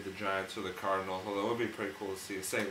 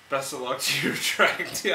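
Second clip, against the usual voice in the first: laughing; 4 semitones higher, 5 dB louder than usual. Real, not acted. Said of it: "I can't even say that with a straight face".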